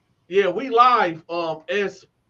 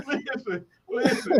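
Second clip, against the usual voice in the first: normal talking voice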